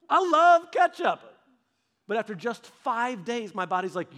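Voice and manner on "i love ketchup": high, slow, loud mock protest; drawn-out comic cry of protest; indignant wail. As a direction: wail it in hammed-up outrage. Mock protest at being laughed at.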